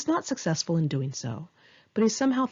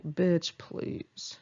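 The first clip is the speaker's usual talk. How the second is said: sassy dismissal; 4 dB quieter than usual. Retold or imitated. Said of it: "I just looked at him like".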